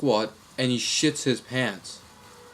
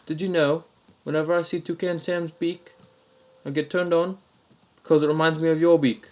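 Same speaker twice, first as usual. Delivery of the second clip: higher-pitched put-on Aussie voice; mock-Australian prank caller, pitched up; Australian accent; 6 semitones higher than usual. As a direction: build to the punchline as an Australian caller. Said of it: "same Australian accent".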